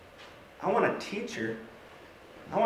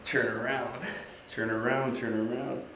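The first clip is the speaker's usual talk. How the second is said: low, soft urging chant; willing her round; 5 semitones lower, 7 dB quieter than usual. Acted. Man wills stranger in line to turn, hushed urging. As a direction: urge her under your breath, low and hushed.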